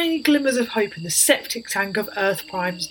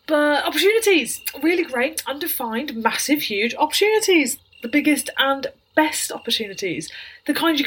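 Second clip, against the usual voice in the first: forced cheer; 5 semitones higher than usual. Acted.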